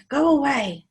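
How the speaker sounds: loud, high go away; grumpy creature voice. Acted. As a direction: shoo him off grumpily. Skellig dismisses him.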